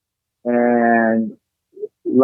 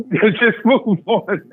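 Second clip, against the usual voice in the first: laughing; 6 semitones higher, much slower than usual. Real, not acted. Teasing friend who can't recall.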